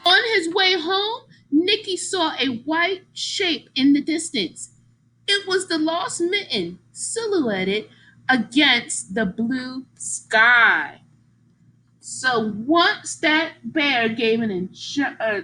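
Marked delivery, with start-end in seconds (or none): 0.0-11.0 storytime read-aloud, slowing at the end; story narration. A narrator's reading. Teacher reading the story to her class, slowing down. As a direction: read it gently, savoring the last words.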